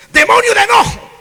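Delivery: very high, loud hammed-up casting out; mock exorcist shout. Preacher mimics another preacher rebuking a demon, in a loud high mock shout. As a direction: yell it like an overzealous exorcist. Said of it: "¿Cómo dijo?".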